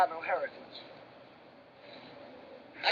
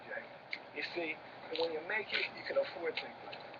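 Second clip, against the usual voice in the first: hushed voice; 4 semitones lower, 10 dB quieter than usual. Acted. Hushed talk about making it.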